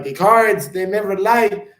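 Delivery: high, loud mock Jamaican accent; Miss Cleo impression. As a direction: proclaim it like a Jamaican psychic. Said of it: "Channeling Miss Cleo there".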